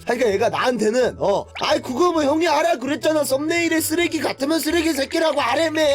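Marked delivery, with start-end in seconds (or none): 1.6-5.8 put-on whiny kid brother. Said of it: "잼민이를 아직 못 벗어났어".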